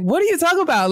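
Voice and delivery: high, baffled question; confused surprise. Retold or imitated. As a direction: pitch it up in disbelief. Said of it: "I was like".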